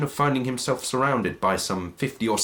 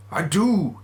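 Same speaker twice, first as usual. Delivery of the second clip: a hint of a cowed old man, higher; lightly voiced Scrooge; 9 semitones higher, 4 dB louder than usual. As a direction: concede it nervously. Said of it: "said Scrooge".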